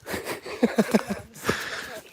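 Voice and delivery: high, breathy chuckle; a run of light, airy giggles; laughing. Real, not acted. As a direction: laugh at your own joke. Laughs at own sacrifice joke.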